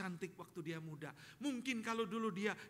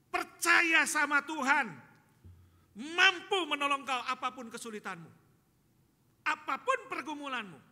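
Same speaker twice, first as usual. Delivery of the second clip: loud, raised-pitch exhortation; impassioned preaching; 8 semitones higher, 15 dB louder than usual. Real, not acted. Preacher urging congregation to trust God, impassioned and loud. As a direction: proclaim it forcefully to the whole room.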